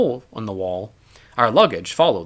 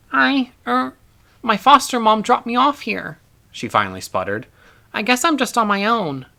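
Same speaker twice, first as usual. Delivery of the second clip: put-on high small voice of a young girl, near tears; a timid lost child, voice pitched up; little girl voice; 10 semitones higher than usual. A narrator's reading.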